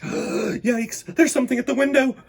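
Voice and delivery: gasp, then high, fast frightened cry; frightened kid hearing a noise at night; scared. Acted. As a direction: sound startled and scared.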